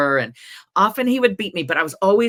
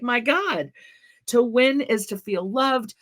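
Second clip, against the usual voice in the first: ordinary talk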